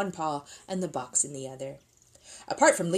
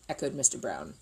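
ordinary delivery

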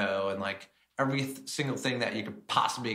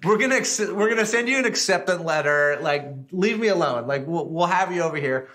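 the chef begging the caller to stop calling; mock exasperated chef; fed-up chef; 8 semitones higher, 8 dB louder than usual. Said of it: "So he basically like".

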